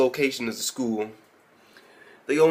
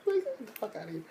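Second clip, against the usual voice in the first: laughing; 9 semitones higher, 11 dB quieter, faster than usual. Real, not acted. Laughing while retelling scene.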